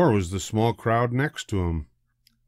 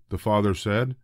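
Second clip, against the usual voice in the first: ordinary delivery, nothing marked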